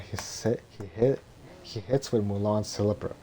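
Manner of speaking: ordinary talk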